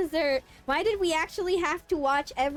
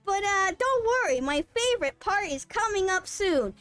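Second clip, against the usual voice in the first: hammed-up audio-guide banter, mostly pitched up; badly acted flirty guides; 4 semitones higher than usual. Museum guides flirting instead of guiding visitors, hammed-up and fake. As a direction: parody two cheesy narrators flirting. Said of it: "two random people with terrible acting trying to flirt and roleplay with each other".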